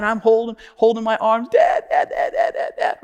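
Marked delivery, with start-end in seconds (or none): 1.5-3.0 high, rapid imitation sobs; mimicked child sobbing. Crying boy in his father's arms, imitated sobbing. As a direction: gasp out the word like a crying boy. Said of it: "And he's crying".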